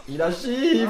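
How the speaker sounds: sudden high yell of alarm; alarmed shout. Real, not acted. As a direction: shout the warning. Killer shifts, panicked warning.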